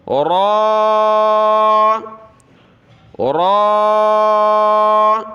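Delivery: را said full and heavy, mouth rounded; a heavy, thick را. Shown as a demonstration. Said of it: "اس کو موٹا پڑھنا ہے".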